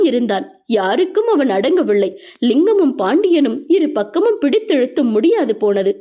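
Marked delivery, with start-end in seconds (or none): none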